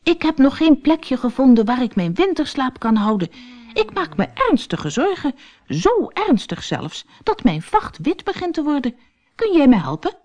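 high, pleading small-animal voice; anxious little stoat. Acted. Stoat begs the otter for a winter spot, high and anxious. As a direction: beg for help, fretting. Barney's voice in story.

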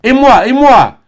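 very high, loud cry; desperate cry. A narrator's reading. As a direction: cry it out one last time. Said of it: "cria une dernière fois Albine".

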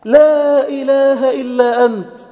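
high, loud, drawn-out declaration; fervent proclamation. Shown as a demonstration. Preacher voicing the believer's prayer, loud and fervent.

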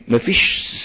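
ordinary talk, nothing marked

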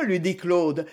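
ordinary delivery

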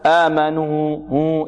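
آمنوا stretched slowly, waw sounded through the nose; demonstrating the wrong nasal waw; drawn-out nasal waw. Shown as a demonstration. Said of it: "لا خطأ".

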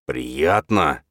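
mild angry growl. A narrator's reading. Father pinning his son by the throat, growling. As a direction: growl it as a menacing taunt. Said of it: "рыкнул отец".

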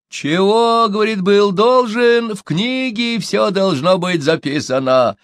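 high, loud, cunning answer; sly. A narrator's reading. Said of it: "хитро ответил шаман".

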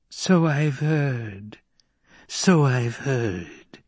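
slow wheezing crone, drawn out; breathless old person. Acted. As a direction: wheeze the words like an old witch. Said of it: "wheezed the old woman".

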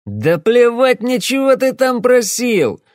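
put-on very high, loud, slow drunken roar; menacing shout. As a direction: blow up at him, threatening. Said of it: "грозно повысил голос".

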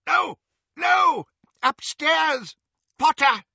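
high, loud cries of a man in pain; desperate cry. A narrator's reading. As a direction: wail it out in panic. Tortured father cries out in pain.

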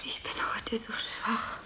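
faint, soft, breathless whisper; weak whisper. Acted. Anna whispering to the landlady that she feels weak. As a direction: sound too weak to stand.

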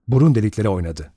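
plain reading voice

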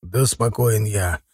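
slow, restrained grumble; through clenched teeth. A narrator's reading. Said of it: "стиснул Захар зубы".

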